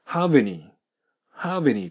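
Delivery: "have any" run together as "ha-veny"; linked sounds. Shown as a demonstration. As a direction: join the V of have onto any. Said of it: "母音がくっついて".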